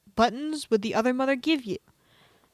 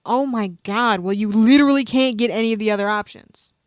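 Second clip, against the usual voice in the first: loud exasperation; 9 dB louder than usual. Real, not acted. Discovers the quiz is rigged.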